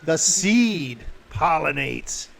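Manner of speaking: very slow, loud creature voice; booming eerie pronouncement; menacing plant creature. Acted. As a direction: draw each word out, landing hard on the last.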